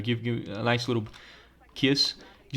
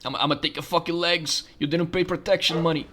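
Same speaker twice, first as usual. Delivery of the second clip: higher put-on gangster voice; mobster threat; 5 semitones higher than usual. Enforcer collecting on unpaid protection money, mock menace. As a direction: threaten like a cartoon mob enforcer. Acting a mobster shooting off legs.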